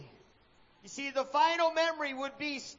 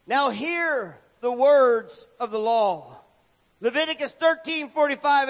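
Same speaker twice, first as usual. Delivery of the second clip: preach; 7 dB louder than usual. Acted. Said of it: "preacher announces scripture".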